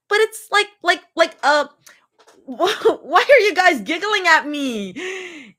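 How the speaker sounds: high, raspy, flustered. Acted. Recovering patient, raspy and flustered at their giggling. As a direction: rasp through the embarrassment. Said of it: "Even with a raspy voice".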